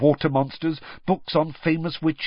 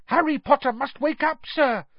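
very high, urgent elf voice; high-pitched elf pleading; squeaky house elf; 13 semitones higher than usual. A narrator's reading. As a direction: squeak it insistently. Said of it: "squeaked Dobby".

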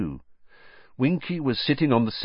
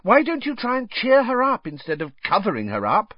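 higher-pitched, exasperated challenge; indignant, emphatic question; 13 semitones higher than usual. A narrator's reading. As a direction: scold them for missing the point.

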